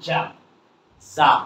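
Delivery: "জাম" with an open "ah" vowel; short A as "ah". Shown as a demonstration. Teacher tells learners what we will say, "জাম" with "ah". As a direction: say "জাম" with "ah", never "æ". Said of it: "জ্যাম বলবো না".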